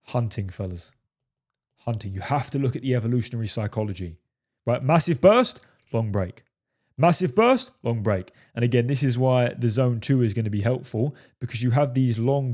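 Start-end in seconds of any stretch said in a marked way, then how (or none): 4.8-5.6 Massive burst, with a loud punch and a rise on burst; burst stressed. Shown as a demonstration. Coach explaining hunting to the fellas, punches burst loud and high. 7.0-7.7 Massive burst, with a loud punch and a rise on burst; burst stressed. Shown as a demonstration. Coach explaining hunting to the fellas, punches burst loud and high.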